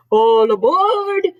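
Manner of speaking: drawn-out storybook Santa holler; conductor's shout. A narrator's reading. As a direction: bellow it like a train conductor. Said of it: "Santa shouted".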